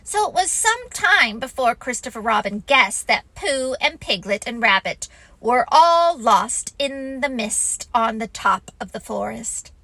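ordinary delivery, nothing marked

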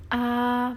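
"a" drawn out high and level; first tone. Shown as a demonstration. Teacher recaps the long tone, level "a". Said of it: "panjang, oke".

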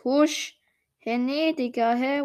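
usual speaking voice

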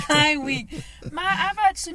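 laughing through it. Real, not acted. Laughing to her friend about finally getting to talk. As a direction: laugh out the words as you take the floor back. Laughing while reclaiming the turn.

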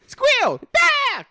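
sudden high, loud squeals; mock screaming attack. Dog's owner demonstrating her screaming attack, mock squeal. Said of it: "That's her attack".

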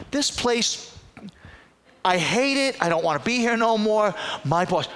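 high, loud mock rant; exasperated venting. Fed-up dealership worker venting to a caller, exasperated. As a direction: imitate a fed-up employee complaining. Retold employee venting about job.

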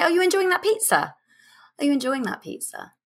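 teasing, a bit naughty, with a smile; sparkle in the eye. Shown as a demonstration. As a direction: flirt lightly with the question. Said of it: "like a sparkle in the eye".

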